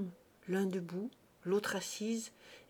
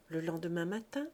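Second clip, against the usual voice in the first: ordinary delivery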